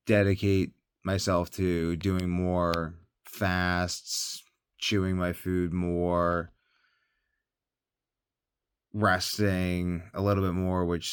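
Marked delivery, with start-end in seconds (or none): none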